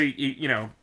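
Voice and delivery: ordinary delivery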